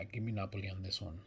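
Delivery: normal talking voice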